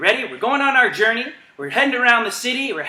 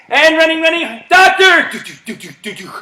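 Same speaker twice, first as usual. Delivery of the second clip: call then heartbeat; 8 semitones higher, 5 dB louder than usual. Real, not acted. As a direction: shout then tap a heartbeat. Calling command then heartbeat.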